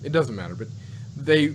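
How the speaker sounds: normal talking voice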